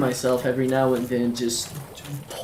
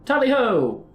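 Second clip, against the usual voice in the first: playful battle cry; 7 semitones higher than usual. Real, not acted. Charging again in game.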